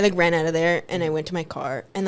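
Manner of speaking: usual speaking voice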